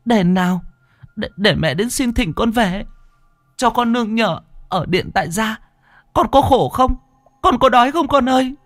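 high, urgent pleading near sobs; sobbing plea. A narrator's reading. Mother pleading with her ghost son, high and sobbing. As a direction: pour out the questions fast, voice breaking high. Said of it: "Cô Thao khóc nấc lên".

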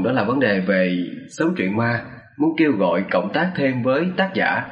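plain reading voice

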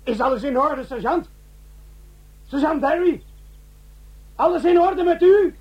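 high, worried calls to the sergeant; anxious calling. Acted. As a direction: call out, scared he is hurt. Calling after the explosion. (bezorgd en hoog roepend)

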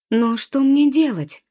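high, loud, drawn-out cry of alarm; panicked question. A narrator's reading. Boy stuck underwater asking the wind, panicked question. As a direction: plead for an answer, voice pushed up. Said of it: "запаниковал Пётр".

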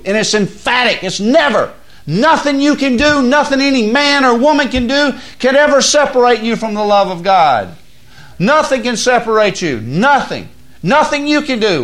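loud, high, driving sermon voice; preaching at full force. Acted. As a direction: build the fire of the sermon.

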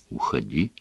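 soft, low, withdrawn; quiet dismissal. A narrator's reading. Son tells his father to go, quietly. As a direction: say it barely above a breath.

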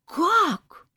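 loud, high, aghast exclamation; horrified. A narrator's reading. Mother and aunt hear of the breakup, horrified. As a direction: cry out in utter dismay. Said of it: "почти хором ужаснулась мама и тётя Зина".

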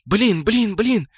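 high-pitched rattled repetition; panicky jabber. A narrator's reading. Mirage reacts to the destruction, high and jabbering. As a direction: blurt it over and over. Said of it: "затараторила рядом Мираж".

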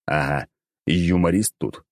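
plain reading voice

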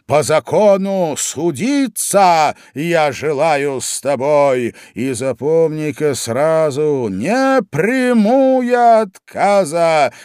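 loud, high, slow declaimed verse; menacing cat. A narrator's reading. Cat tells poor mouse he will sue, slow and menacing. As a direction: draw out the threat, building to a loud peak.

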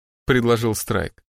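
ordinary delivery, nothing marked

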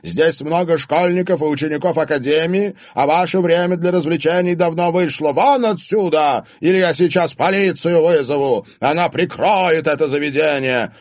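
loud, high-pitched haranguing; angry public order. A narrator's reading. Father threatens partygoers with police, shouting. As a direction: order everyone out forcefully.

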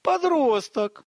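agitated high-pitched hollering; cook's yelling. A narrator's reading. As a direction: call out excitedly in a high voice. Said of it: "заорал мастер-повар".

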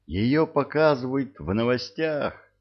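called out from the bedroom; shouting from another room. A narrator's reading. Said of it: "крикнул из спальни Артур".

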